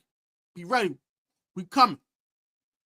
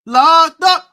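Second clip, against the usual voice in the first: loud hype; 6 semitones higher, 12 dB louder than usual. Real, not acted. Hyped trash talk before game.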